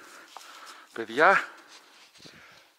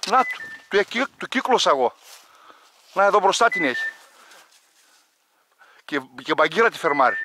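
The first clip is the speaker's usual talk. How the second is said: loud, higher, urgent pointing out; excited calling; 4 semitones higher, 7 dB louder than usual. Real, not acted. Hunter urgently pointing out where the bird is hiding. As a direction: hurry them, the bird is right there. Urging dog, bird about to flush.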